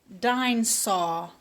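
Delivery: put-on "Dinosaur" said slowly as "dinsaw", babyish; toddler mispronunciation. Teacher mimics George's baby "Dinosaur" for the class. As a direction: say "Dinosaur" clipped and wrong like a little kid. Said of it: "Does that sound right? No".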